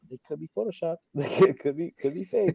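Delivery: high, giggly it-could-be-fake; laughing suspicion. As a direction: laugh through the doubt. Suspicious inner thought, laughing.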